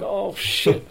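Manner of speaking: a laughing groan at being caught out; laughing dismay. Retold or imitated. Recalling his embarrassment at the fans, laughing. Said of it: "And I'm like".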